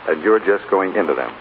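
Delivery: usual speaking voice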